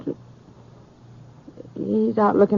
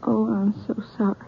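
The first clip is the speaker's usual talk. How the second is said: ordinary talk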